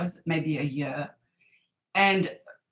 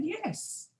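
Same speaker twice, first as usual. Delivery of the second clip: a light high little "Yes"; reply lightly in a high voice; Mrs Hirschfeld's answer; 14 semitones higher, 9 dB quieter than usual. Retold or imitated. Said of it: "And she said".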